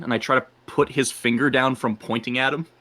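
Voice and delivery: ordinary talk, nothing marked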